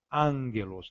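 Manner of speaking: angelos with an N before the g; Greek pronunciation. Shown as a demonstration. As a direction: say angelos as an-gelos, the double gamma nasal. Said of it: "O primeiro G se torna N". (angelos, o primeiro gama vira N)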